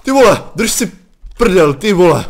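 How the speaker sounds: high, loud frantic cursing; panicked swearing. Real, not acted. Gamer just jumpscared, high loud panicked cursing.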